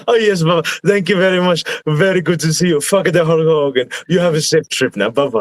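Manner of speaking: mock-gracious farewell with a curse tucked in; pleasant old Sheik. Wrestler imitates the Sheik greeting fans, pleasantly polite. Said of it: "he would stay pleasant".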